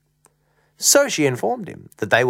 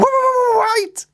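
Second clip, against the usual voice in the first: very high, stuttered panicky cry; 5 dB louder than usual. Acted. Luke stops mum at the cupboard, crying out. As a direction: cry out high, stammering to stop her. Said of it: "Luke cried out".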